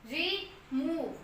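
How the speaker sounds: रिमूव slowly, long मू held; slow demo word. Shown as a demonstration. Teacher slowly models रिमूव with long u. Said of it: "ओ हम long pronounce कर रहे हैं".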